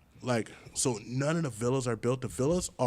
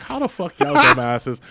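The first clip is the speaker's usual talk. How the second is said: raised-voice mock scolding; loud incredulity; 4 semitones higher, 11 dB louder than usual. Comic scolding kids at the festival, loud incredulity. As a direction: holler it in comic disbelief. Retold reaction to kids falling for it.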